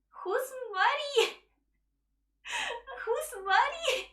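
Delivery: high, slow, baffled question with a laugh between; baffled who's Mari. Retold or imitated. Caller names a stranger, she answers high and baffled. Confused reply to stranger's call.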